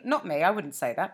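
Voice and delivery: usual speaking voice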